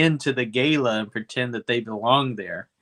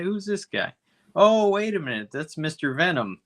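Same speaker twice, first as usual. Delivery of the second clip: higher, sudden dawning recognition; retold realization; 6 semitones higher than usual. Recalling spotting the poster actor, surprised recognition. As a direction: catch yourself recognizing him.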